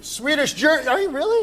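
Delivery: mock disbelief. Real, not acted. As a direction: pitch leaps up in playful disbelief. Host's mock disbelief at Swedish German.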